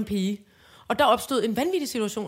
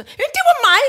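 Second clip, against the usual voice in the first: high, loud boast claiming credit; mock show-off crying it was mine; bragging voice; 14 semitones higher, 12 dB louder than usual. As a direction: shout that it was all your idea. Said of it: "siger man så".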